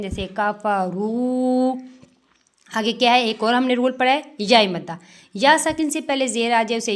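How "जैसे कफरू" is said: कफरू with रू held one count; waw madd. Shown as a demonstration. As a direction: stretch the रू of कफरू. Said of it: "one second एक अलिफ जितना". (कफरू में वाव मद्दा)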